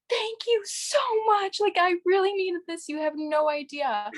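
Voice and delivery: overwhelmed gratitude, mildly imitated; teary thanks. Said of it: "I literally burst into tears".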